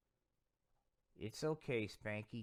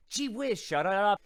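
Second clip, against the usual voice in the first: much higher and louder, a sudden hammed-up snap; mock angry outburst; 6 semitones higher, 9 dB louder than usual. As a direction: blurt it out as a cartoon tantrum. Puppet snaps back at the host.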